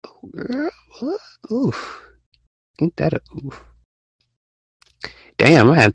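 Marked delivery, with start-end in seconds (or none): 0.1-3.6 put-on high, soft teasing girlfriend voice; mock chat voice. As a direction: play the gossiping friend, quietly. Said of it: "Y'all, y'all like".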